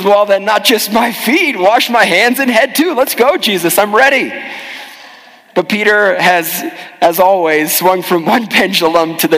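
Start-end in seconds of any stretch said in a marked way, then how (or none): 0.0-4.3 higher, eager, rushing to agree; eager flip-flop. Retold or imitated. Peter suddenly eager for Jesus to wash all of him, higher and keen. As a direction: swing over to keen enthusiasm. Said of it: "this is one of his quickest flip-flops".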